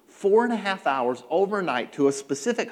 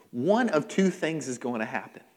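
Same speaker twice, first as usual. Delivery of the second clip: ordinary talk, nothing marked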